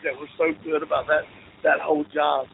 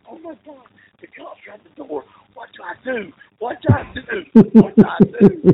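high mock panic, hushed then building louder; panicked whisper-yelling; 8 semitones higher, 4 dB louder than usual. Friend with cops at the door, whisper-yelling in panic. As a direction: imitate a frantic voicemail, rising from whisper to yell. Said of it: "whisper yelling into the phone".

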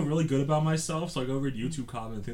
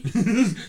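loud laugh; 8 semitones higher, 10 dB louder than usual. Real, not acted. Laughing at the reply.

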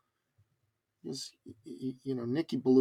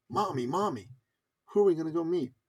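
higher, eager child imitation; excited little boy; 5 semitones higher than usual. Little son asking his mother, excited. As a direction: pester Mommy like an excited kid. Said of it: "how little boys do when they get excited".

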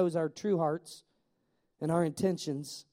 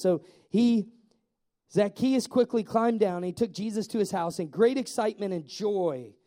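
ordinary talk

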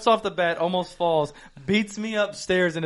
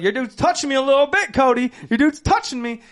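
retold complaint; 6 semitones higher, 5 dB louder than usual. Retold complaint called to Cody.

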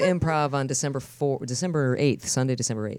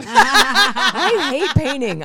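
slow, laughing groan of distaste; 6 semitones higher, 8 dB louder, much slower than usual. Real, not acted. To a friend painting her kitchen, laughing. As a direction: stretch it out while laughing.

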